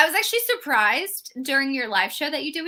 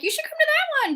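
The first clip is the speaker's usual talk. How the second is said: breezy imitation of a friend urging her to come; light high quote of a friend's invite; mock friend voice; 3 dB louder than usual.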